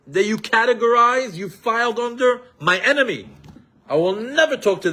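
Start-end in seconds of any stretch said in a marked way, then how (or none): none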